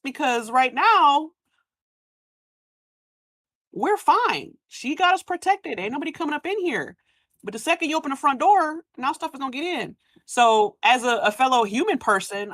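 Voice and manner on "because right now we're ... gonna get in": high, pleading villager voice; mock clan voice. Voicing a villager begging the rebel not to open the door. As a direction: whine it like a worried villager.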